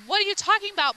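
high-pitched acted protest; indignant challenge. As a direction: throw it back, mock indignant. Retold argument with doctor.

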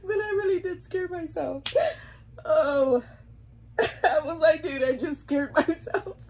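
high, loud, teary laughing voice; crying with laughter. Real, not acted. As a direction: tell it while still cracking up. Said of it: "I'm crying 'cause it's just so funny".

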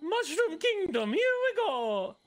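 high, drawn-out cheery hero cry; game hero catchphrase. Acted. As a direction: stretch each word into a high, gleeful launch cry. Playful shouted game catchphrase, acted.

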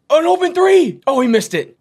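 very high, loud call dropping into dismay; excited shout. Real, not acted. Commentator calling the missed game winner, excited shout. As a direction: shout the shot, then come down.

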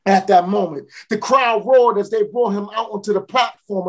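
normal talking voice

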